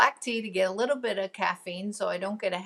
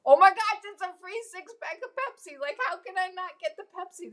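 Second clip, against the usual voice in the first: much higher, mock-thrilled squeal; excited squeal; 11 semitones higher, 3 dB louder than usual. Mom gushing to her kids over a free Pepsi coupon, much higher and thrilled. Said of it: "I'm like".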